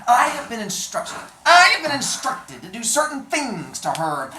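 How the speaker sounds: high, loud cold dwarf; hunchback dwarf voice. Acted. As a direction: threaten coldly, high and loud. Vizzini states his orders.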